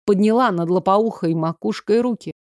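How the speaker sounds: usual speaking voice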